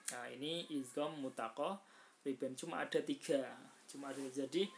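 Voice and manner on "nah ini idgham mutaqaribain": ordinary talk, nothing marked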